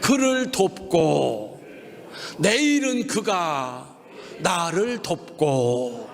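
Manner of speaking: slow, measured phrase for repeating; slow recitation. Shown as a demonstration. Preacher leading congregation repetition, slow and measured. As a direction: say each chunk slowly for the crowd to echo. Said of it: "한번 따라합시다".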